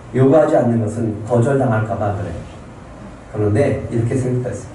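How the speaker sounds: usual speaking voice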